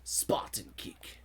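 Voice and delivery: breathy whispered callout, very slow. Acted. Gamer whispering his attack to himself, slow whisper. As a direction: hiss the move name like a secret. Whispered move name in game.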